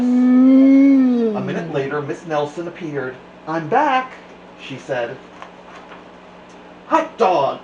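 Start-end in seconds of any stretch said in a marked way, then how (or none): 0.0-2.0 high, slow drawn-out; sing-song. A narrator's reading. 3.5-4.1 high bright sweet teacher; sweet cheerful teacher. A narrator's reading. Nelson voiced. 6.9-7.6 loud happy cheer; excited cry. A narrator's reading. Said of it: "cried the kids".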